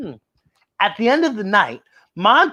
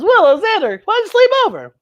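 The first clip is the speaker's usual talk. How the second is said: put-on much higher, louder child voice calling friends; little Buffy calling; 13 semitones higher, 5 dB louder, faster than usual. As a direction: call out like an eager little girl. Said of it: "she says".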